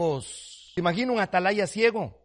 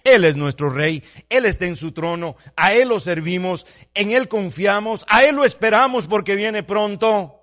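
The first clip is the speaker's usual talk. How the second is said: loud, ringing proclamation of the king; preaching shout; 7 dB louder than usual. Real, not acted. Preacher to congregation, loud proclaiming shout. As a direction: cry it out to the congregation.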